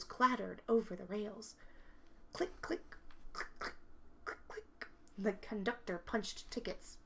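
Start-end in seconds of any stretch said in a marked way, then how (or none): 2.3-4.9 whispered ticket-punch clicks; soft clicks. Acted.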